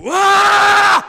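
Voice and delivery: huge, high, full-throated release scream; car scream. Shown as a demonstration. Driver alone in his car, letting out a huge scream. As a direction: let out a wild primal yell. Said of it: "esse grito".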